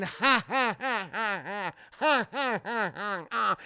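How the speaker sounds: repeated even ha-ha cackle; looping mock laugh; mechanical Joker laugh. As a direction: imitate a spinning animatronic clown laughing. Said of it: "his head just spinning around going".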